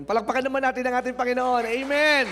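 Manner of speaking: loud, high call for applause; rousing call. Real, not acted. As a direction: lift the crowd to clap for the Lord. Calling for applause.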